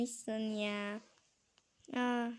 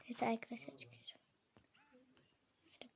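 fısıltı; 12 dB quieter, faster than usual. Real, not acted. Gizlice ayrılırken fısıldar.

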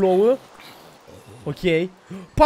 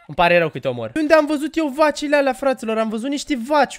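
ordinary talk

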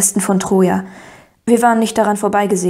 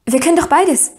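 higher-pitched, eager answer; quick eager reply; 8 semitones higher than usual. A narrator's reading. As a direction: jump in eagerly. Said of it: "sagte ich rasch". (eifrig eingeworfen)

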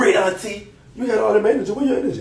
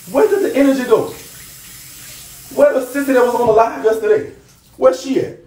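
high, loud taunting question; taunting call-out, pitched up; 7 semitones higher, 3 dB louder than usual. Real, not acted. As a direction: jeer where the sissy went. Taunting the rival again.

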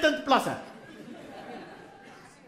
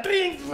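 phone ring; 5 semitones higher than usual. Retold or imitated.

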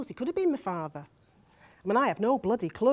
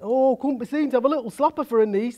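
louder, mocking imitation of snobbish relatives; disapproving aunt and uncle; 2 semitones higher, 5 dB louder than usual. Niece quotes her aunt and uncle on her pregnancy, mocking imitation. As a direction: mimic the scandalised relatives. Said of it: "they said".

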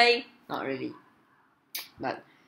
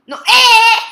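very loud, high yelped command; frantic shout; 17 dB louder than usual. Real, not acted. Player shouting at the voice-controlled game, frantic. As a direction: yelp it out at full voice.

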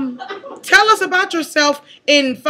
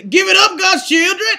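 high, loud rallying shout; mock congregation rouser; imitated hype preacher; 8 semitones higher, 4 dB louder than usual. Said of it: "I don't know who that man was".

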